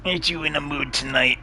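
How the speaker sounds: cooing at the cat. Real, not acted. Talking to her sulky cat, high cooing pet voice. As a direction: tease the cat gently, voice lifted high.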